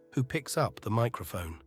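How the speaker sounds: usual speaking voice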